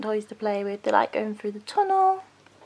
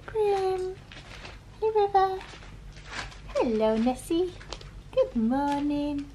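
puppy talk; 7 semitones higher, 3 dB quieter than usual. Real, not acted. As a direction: coo at the pups like tiny babies. Morning greeting to the puppies.

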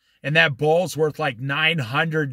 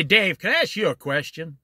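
ordinary delivery

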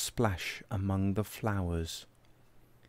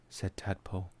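usual speaking voice

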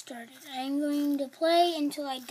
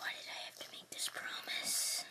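fearful regretful whisper; 11 dB quieter than usual. Real, not acted. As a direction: whisper it, dreading what's next. Scared alone in dark game.

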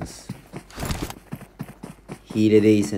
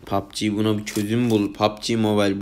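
ordinary delivery

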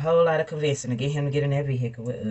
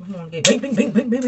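singsong bings; 7 semitones higher, 7 dB louder than usual. Pretend. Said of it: "mock sound".

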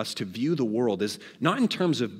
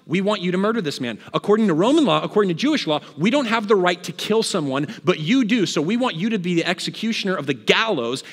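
higher, forceful, pressing demand; insistent demand; 5 semitones higher, 5 dB louder than usual. Acted. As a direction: push the point hard, pitched up. Leaders insistently demanding execution.

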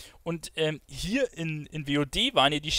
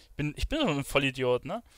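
ordinary talk, nothing marked

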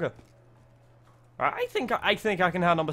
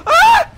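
sudden high, loud yelp; startled scream; 18 dB louder than usual. Real, not acted. Player jumped by something in the game, startled scream. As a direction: scream in real fright.

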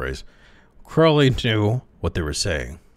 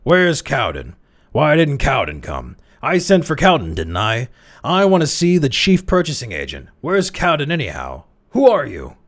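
higher and louder angry bursts; exploding; 8 semitones higher, 6 dB louder than usual. A narrator's reading. The old chairman barks at Crowley, exploding with anger. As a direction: fire the questions like fireworks. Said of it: "words exploded in bunches like packs of fireworks".

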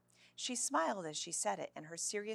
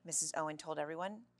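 plain reading voice

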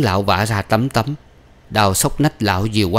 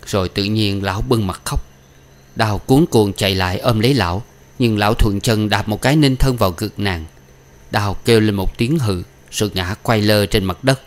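usual speaking voice

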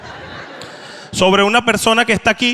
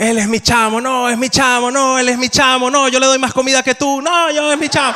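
each voice climbs higher, outbidding the last; friends squabbling, building higher; 7 semitones higher than usual. Retold or imitated. Peruvian friends fighting over their chamo, getting more and more excited. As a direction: get more eager with every claim until it breaks into a laugh.

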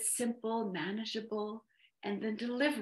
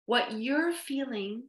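slow phrase with YOU'RE lifted; YOU'RE made to stand out, unhurried; stress on YOU'RE; 3 semitones higher, slower than usual. Shown as a demonstration. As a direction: stretch and emphasize the word "you're". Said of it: "notice how I emphasize your".

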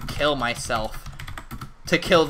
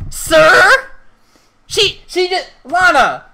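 much higher, startled; 14 semitones higher, 6 dB louder, slower than usual. Real, not acted. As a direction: squeak in shock. Stylist misspeaks.

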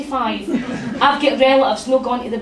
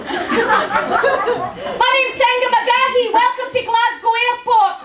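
high, slow muffled reporter voice; local news reporter; 11 semitones higher, slower than usual. Retold or imitated. Rookie reporter speaking through a zipped-up cagoule, high and slow. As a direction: drawl it slowly, high, face zipped in a cagoule. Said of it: "Senga's first day in the job".

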